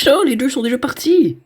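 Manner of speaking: high, loud startled cry; panicking gamer. Real, not acted. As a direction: shriek in sudden panic. Sudden jumpscare loss. (cri de panique)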